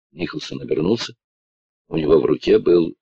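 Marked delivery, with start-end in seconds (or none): none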